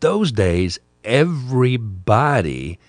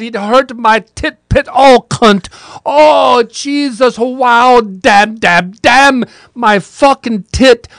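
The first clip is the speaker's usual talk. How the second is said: high-pitched, loud, pained howl of curses; frantic yelps of pain and swearing; 10 dB louder, slower than usual. Retold or imitated. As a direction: shriek it like a man clutching a hurt shoulder. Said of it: "This slew of words that he put together".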